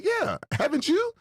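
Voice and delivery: put-on a light high-pitched girlfriend reply; girlfriend's high voice. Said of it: "She said".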